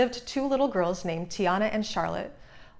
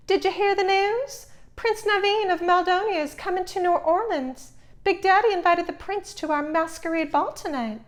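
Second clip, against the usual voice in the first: put-on much higher, bubbly rich girl gushing; excited high girlish voice; 10 semitones higher than usual. A narrator's reading. Charlotte gushing to Tiana about the prince. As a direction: squeal out the big news.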